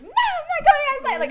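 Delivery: high, loud panicked refusal; mock scared. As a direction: squeal it in exaggerated terror. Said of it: "Like, I was scared".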